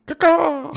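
loud, high squawked caw; shrieking mock bird call; bird caw imitation. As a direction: screech like a gull, playfully.

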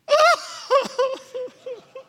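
a high, loud burst of happy laughter; joyful laugh. Retold or imitated. Waitress bursting into laughter at the news, high and loud. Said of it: "And she went".

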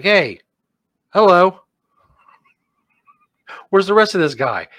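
high, loud comic hello; calling out. Acted. Host voicing a bit peeking round the corner, calling out.

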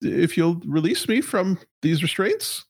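high, nervous corporate stammer; a flustered high-pitched executive. Acted. GM holds executive voice.

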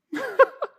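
bright burst of laughter at the joke; quick amused laugh; short high laugh. Real, not acted. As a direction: laugh at your own bit.

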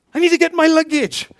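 high, loud sudden urgency; urgent thought. Retold or imitated. Preacher recalling the train moving off, high loud urgency. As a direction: blurt it out in a rush of alarm. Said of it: "I'm thinking".

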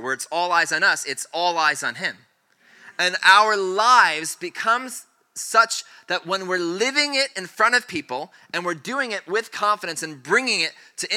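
high-energy sermon delivery; impassioned preaching. Real, not acted. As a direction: preach it with building fervor, landing hard on key words. Preaching at full force to congregation.

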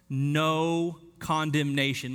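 No condemnation drawn out very slowly; slow emphatic repeat. Shown as a demonstration. Preacher to the congregation, slow weighty stress. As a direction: lean on No, slow it right down. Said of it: "Let that fall over you".

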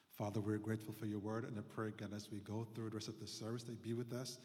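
quick, earnest spoken prayer. Real, not acted. Pastor praying over the congregation, quick and earnest. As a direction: pray for the congregation, briskly. Pastor prays before the sermon.

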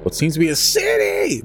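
shrieked way up high in a mock panic; hammed-up high shriek of the pilot spotting a city; mock scream. Pilot spotting a city over Antarctica, mock high shriek. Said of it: "he's screaming this".

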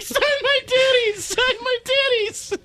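put-on very high, slow squealing fan girls; mock fangirl squeal, laughing; screaming fan girls. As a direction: shriek it like groupies at the show. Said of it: "girls waiting ... like".

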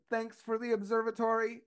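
lightly imitated high robotic lady; a hint of a robotic old lady. Old lady voiced in story.

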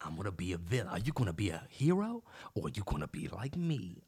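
low, menacing movie-villain imitation. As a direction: drop low like a brooding bad guy. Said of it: "Michael B. Jordan uses this villain voice".

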